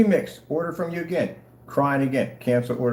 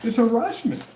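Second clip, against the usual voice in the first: mock complaining seller; 7 semitones higher than usual. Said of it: "change it at the last minute and go".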